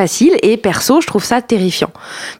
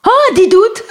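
surprised; 13 semitones higher, 3 dB louder, much slower than usual. Real, not acted. Mock shock at Darty joke.

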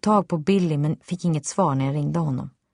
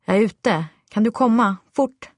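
raised, rising call that ends high on Fort; urgent call; 6 semitones higher, 4 dB louder than usual. A narrator's reading. Mother calling her neighbour up the stairs, urgent and rising. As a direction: hurry her up the stairs, voice climbing.

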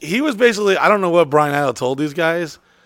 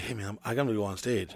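hurried soft brush-off; 6 semitones lower, 14 dB quieter, faster than usual. Retold or imitated. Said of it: "I was kinda like".

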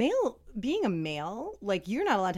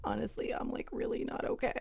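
retold confession in a near whisper; 5 semitones higher, 4 dB quieter than usual. Client admits to trainer they are not okay, whispered. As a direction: whisper it, reliving the admission.

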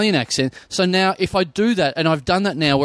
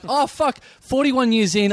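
mock realization; 9 semitones higher, slower than usual. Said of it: "And I'm like".

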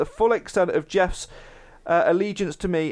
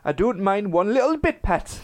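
put-on light Geordie taxi-driver accent, a bit higher; a lightly done Geordie accent for Jeff's reply; 5 semitones higher than usual. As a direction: give the line in an easy Geordie lilt. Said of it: "can I do the accent?".

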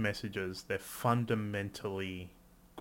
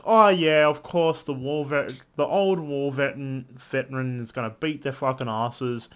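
mock excited; 2 semitones higher, 7 dB louder than usual. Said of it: "I was just sort of like".

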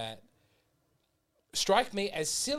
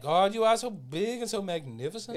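higher, hammed-up liturgical praise; imitated grand prayer; 7 semitones higher, 4 dB louder than usual. Mocking how English prayers are said at Mass, hammed-up grand praise. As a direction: intone it grandly, sending up the style. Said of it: "when you say it like that".

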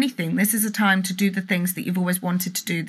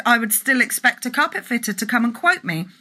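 ordinary talk

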